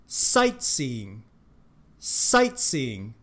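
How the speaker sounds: sightseeing 重音放在前面的 sight，慢慢念; 把 sightseeing 的重音放在 sight; stress on sight. Shown as a demonstration. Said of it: "重音是在前面，sight". (慢速示范 sightseeing 重音在前)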